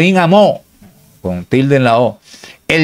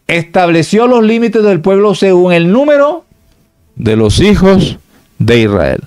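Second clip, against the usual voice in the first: raised, measured reading of a verse; scripture reading; 6 semitones higher, 4 dB louder than usual. Acted. Preacher reading scripture to the congregation, raised and measured. Reads Bible verse aloud.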